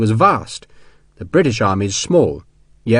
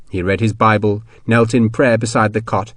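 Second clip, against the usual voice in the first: usual speaking voice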